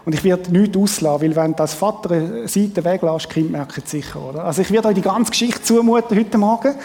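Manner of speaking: usual speaking voice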